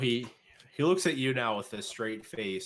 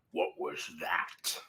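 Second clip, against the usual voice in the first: gruff orc leader; 6 semitones lower, 4 dB quieter, slower than usual. Acted. Orc leader hears noise outside.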